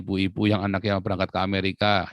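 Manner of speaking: ordinary talk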